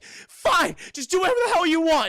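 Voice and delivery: high, loud, fast outburst; hurt, fed-up yelling; exasperated shout. Acted. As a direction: throw up your hands in anger. Jennifer gives up in a quarrel.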